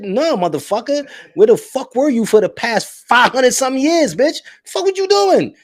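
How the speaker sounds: high, loud exasperated yelling; exasperated rant. Real, not acted. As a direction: vent it, voice climbing high. Ranting at ignorant people.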